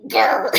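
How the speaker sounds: playful little growl; mock growl. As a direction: growl like an annoyed cat, half joking. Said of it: "And here I am, like".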